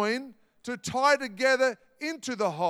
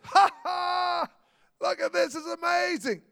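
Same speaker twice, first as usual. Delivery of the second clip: high, laughing delight at a wonder; joyful laugh; 7 semitones higher than usual. Retold or imitated. Amazed disciples after the storm, high laughing delight. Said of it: "one of those laughs".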